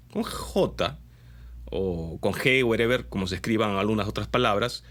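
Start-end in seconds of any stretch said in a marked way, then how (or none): none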